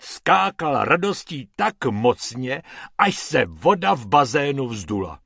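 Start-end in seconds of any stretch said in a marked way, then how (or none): none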